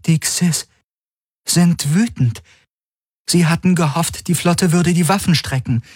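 high-pitched, measured character voice; Helix character voice. A narrator's reading. Helix relaying the enemy's mood to the crew, high voice. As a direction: report in a high voice, phrase by phrase.

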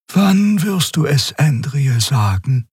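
put-on high, slow young woman's questioning voice; young companion asking gently; Xea's voice. A narrator's reading. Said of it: "fragte Xea".

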